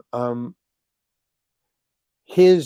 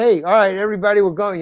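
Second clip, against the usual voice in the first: loud call to the whole group; 7 semitones higher, 6 dB louder, faster than usual. Retold or imitated. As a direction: round everyone up with a raised call. Calling the group to leave.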